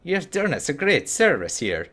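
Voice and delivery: a hint of a Scottish dwarf; Scottish accent. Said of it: "doing the Scottish voice".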